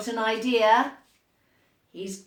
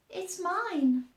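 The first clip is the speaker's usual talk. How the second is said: little mouse voice; 7 semitones higher than usual. A narrator's reading. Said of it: "said the little mouse".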